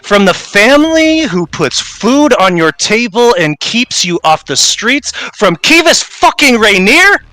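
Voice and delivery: Caspian's entitled menace rising into a furious high yell; growled threat, building to a shout. Acted. Caspian rages at the kneeling father, building from growl to shout. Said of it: "you hear him growl out".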